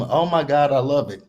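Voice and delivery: normal talking voice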